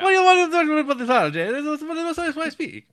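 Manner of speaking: high, loud, hammed-up Italian accent. Benigni the cabbie rambling to the dying priest, loud hammy Italian accent. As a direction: ham up a babbling Italian cabbie. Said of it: "You do it again".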